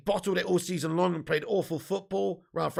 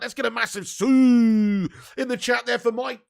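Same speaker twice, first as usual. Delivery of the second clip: raised, louder, with a long drawn-out SUE; hype shout; 5 semitones higher, 8 dB louder than usual. Real, not acted. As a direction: call out the celebration cry to the crowd. Hyping chat with celebration cry.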